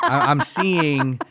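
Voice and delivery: giggly slow start; laughing. Real, not acted. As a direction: talk through a laugh at your own joke. Laughs at her own joke.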